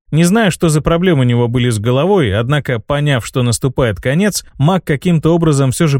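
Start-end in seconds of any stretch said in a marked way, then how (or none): none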